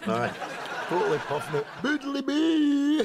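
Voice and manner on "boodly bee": stoned nonsense. Acted. Stoned character babbling nonsense.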